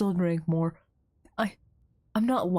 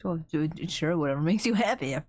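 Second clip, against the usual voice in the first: ordinary delivery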